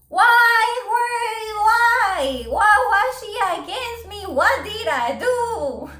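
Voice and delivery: loud, high, repeated cry of why; exasperated why. Real, not acted. Vlogger asking viewers why she was targeted, loud and high. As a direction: throw up your hands and wail why.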